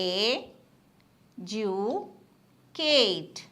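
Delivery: "എ, ജു, കേറ്റ്" split into three separate beats with long pauses; syllable by syllable. Shown as a demonstration. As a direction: say "എ, ജു, കേറ്റ്" one syllable at a time, pausing after each. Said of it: "syllable പറയാം".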